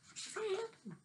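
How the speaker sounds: high, soft wondering voice; suspenseful question. Acted. Reading to a child, a high hushed suspense question. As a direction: float the question up in a hush.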